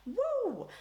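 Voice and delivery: playful shocked whoop; high mock whoo. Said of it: "it was like".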